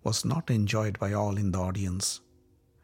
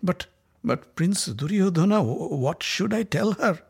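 feeble voice; 6 semitones higher than usual. Acted. Scared messenger stammers to the angry prince. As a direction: stutter like a servant afraid of his master. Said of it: "He said in a feeble voice".

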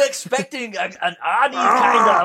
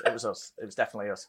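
much softer, lower joking insistence; mock muttered claim; 10 semitones lower, 9 dB quieter, much faster than usual. Real, not acted. Cohost jokingly claims credit for actress's success, softly. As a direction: murmur it with mock pride.

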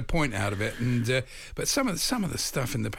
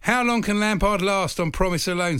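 mocking headline quote; 7 semitones higher, 5 dB louder than usual. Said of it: "says Alison Rudd".

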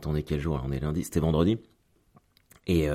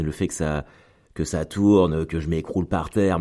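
usual speaking voice

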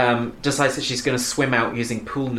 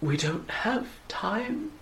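slow, much softer, higher mock-solemn line; hammy line; 7 semitones higher, 9 dB quieter, much slower than usual. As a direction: breathe it like a bad soap star. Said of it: "hammy-ass dialogue".

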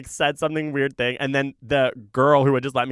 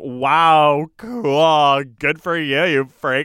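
mock-impressed, louder at the start; mean sarcasm; 2 semitones higher, 6 dB louder, much slower than usual. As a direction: imitate a scornful party leader brushing off a kid. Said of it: "a really mean way".

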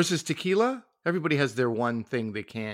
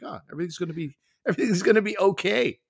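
higher, sighing voice of someone reassured by a liqueur; mock soothed drinker; 8 semitones higher, faster than usual. Co-host mimicking what the elderflower liqueur makes you feel, mock blissful. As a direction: play a drinker melting into comfort, laughing at the end. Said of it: "and you're like".

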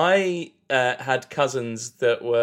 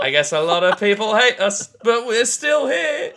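mock rallying announcer; 8 semitones higher than usual. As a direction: build higher like addressing a rally. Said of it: "we come out and go".

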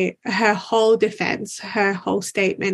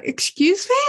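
high-pitched, baffled comeback; confused disbelief; 9 semitones higher than usual. Retold or imitated. Witness baffled by the lawyer's accusation, high confused disbelief. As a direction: retell it as a baffled, offended question. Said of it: "I was just so confused".

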